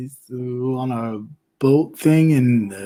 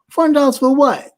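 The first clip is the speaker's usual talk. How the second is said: high, loud incredulous question; outraged disbelief; 10 semitones higher, 3 dB louder than usual. Retold or imitated. Friend retells hearing the bottle price, high and incredulous. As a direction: act stunned at the price.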